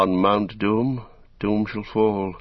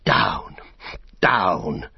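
gasped command, each word on its own; lightly acted gasp. A narrator's reading. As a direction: order it off, short of breath. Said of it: "he gasped".

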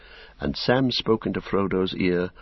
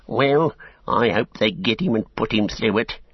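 higher-pitched, spiteful orc; growling orc tracker; 5 semitones higher than usual. A narrator's reading. Tracker hoping they punish the sneak, spiteful. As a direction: wish harm with mild growl. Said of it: "growled the tracker".

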